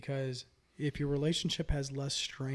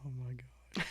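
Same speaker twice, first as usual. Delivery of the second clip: whispered, softer; 8 dB quieter than usual. Real, not acted. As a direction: breathe it out in disbelief. Whispered stunned reaction.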